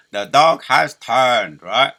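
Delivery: put-on very loud, slow British Churchill accent; Churchill impression. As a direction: boom it like a wartime Churchill speech. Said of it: "I'm even mocking his, his accent".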